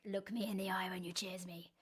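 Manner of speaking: a mock-creepy hushed demand; creepy whisper. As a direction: whisper it, leaning in like a creepy weirdo. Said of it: "a creepy weirdo about it".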